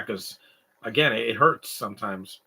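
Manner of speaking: ordinary talk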